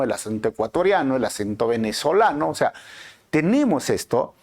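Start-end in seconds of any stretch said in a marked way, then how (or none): none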